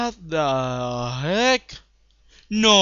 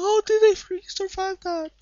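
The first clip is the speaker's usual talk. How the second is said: fast high whining disbelief; incredulous outburst; 13 semitones higher, faster than usual. Real, not acted. Gamer after failed kill, fast incredulous whine. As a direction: rush it out, aggrieved.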